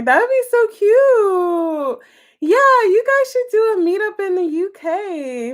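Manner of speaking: delighted, gushing; excited. Real, not acted. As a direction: squeal over the meetup idea. Excited about a meetup.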